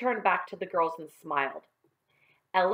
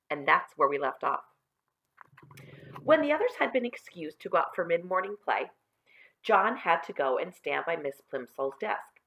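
ordinary delivery

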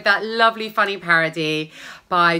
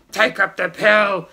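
grumpier; 6 dB louder than usual. Acted. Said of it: "that's supposed to be a bit more grumpy".

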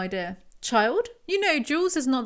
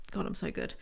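whispered aside; 2 semitones lower, 11 dB quieter than usual. Real, not acted. Smug aside to herself.

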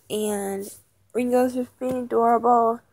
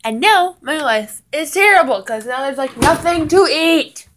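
higher, louder exaggerated complaint; mock dramatic whine; 4 semitones higher, 6 dB louder than usual. Real, not acted. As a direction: ham up the misery. Dramatic complaint about food.

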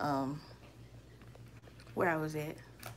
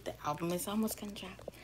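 secretive whisper; 10 dB quieter than usual. Real, not acted. Secret aside to viewers.

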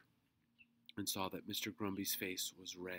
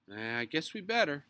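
loud, raised, easygoing agreement; cheerful agreement; 7 semitones higher, 12 dB louder than usual. A narrator's reading. Robert agreeing to climb out of the tree, cheerful. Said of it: "agreed Robert cheerfully".

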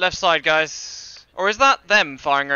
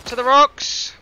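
higher, projected squad command; called-out order; 7 semitones higher, 4 dB louder, slower than usual. Pretend. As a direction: call it across to the squad. Squad leader calling out orders.

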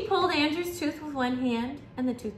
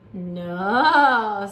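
loud, low, drawn-out no; big low storybook "No"; emphatic refusal; 4 semitones lower, 7 dB louder than usual. A narrator's reading. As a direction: shoot the guess down, low and loud. Dentist firmly contradicts the boy.

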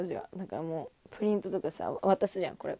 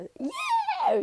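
excited cheer; 4 dB louder, much slower than usual. Retold or imitated. Cheering once she stopped hiding.

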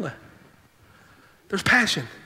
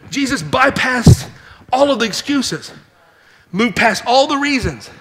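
louder, higher, slow preaching climax; full-force preaching; 6 semitones higher, 4 dB louder, slower than usual. Acted. Preacher to congregation on Jesus bypassing excuses, full-force preaching. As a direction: drive it home like a revival sermon.

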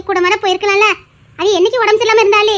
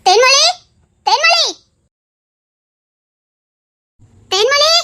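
calling out a name; 7 semitones higher, 3 dB louder than usual. Acted. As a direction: call the name out high across the house. Calling Thenmozhi through the house.

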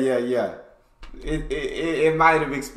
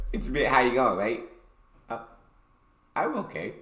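softly mimicking an Australian exchange; quiet mock-Australian accent; imitated Australian accent; 3 semitones lower, 6 dB quieter, faster than usual. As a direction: echo the Aussie skit under your breath, lightly. Mimicking the skit just played.

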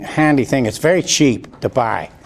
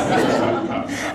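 breathy laughing under the breath; real quiet chuckle; soft laugh; 4 dB quieter than usual. Real, not acted. After the duct tape story.

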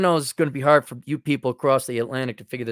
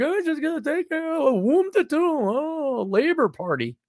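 much higher, softer airy mock voice; mock girly voice; 10 semitones higher, 4 dB quieter than usual. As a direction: lampoon a naive voter. Mocking women voters.

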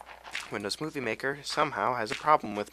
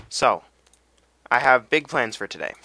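louder, proud declaration of plans; loud announcement; 2 semitones higher, 9 dB louder than usual. Real, not acted. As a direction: announce it big to the viewers. Announces plans, then repeats calmer.

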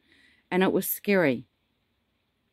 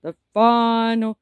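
dramatic announcement; 6 semitones higher, 9 dB louder, slower than usual. Pretend. Dramatic announcement after hummed fanfare.

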